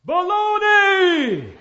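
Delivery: high, very drawn-out holler; echo yelling back. Acted. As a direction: yell back like a canyon echo, stretching it long. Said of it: "The echo comes back".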